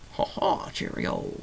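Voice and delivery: lightly hammed-up British accent; half-hearted attempt at sounding British; mock British accent. Vlogger trying British after a failed attempt, in mock British accent. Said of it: "now I just sound like it".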